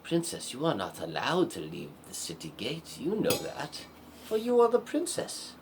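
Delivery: lightly voiced guard, swooping high in places; a hint of a formal gatekeeper; city guard. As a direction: warn the princess like an official guard. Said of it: "the two guards protecting the city gate said".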